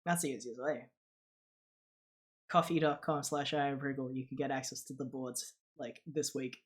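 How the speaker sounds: normal talking voice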